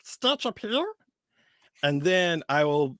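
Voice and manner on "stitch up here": high, Stitch-like alien voice. Shown as a demonstration. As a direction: start the goblin from Stitch up high. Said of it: "I always start like".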